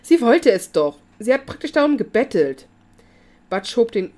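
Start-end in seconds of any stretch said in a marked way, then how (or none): 0.0-2.7 loud, rushed, panicky excuse; blurted out frantically. A narrator's reading. Cornered Billy blurting excuses to the cop, frantic and loud. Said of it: "stieß er hektisch hervor".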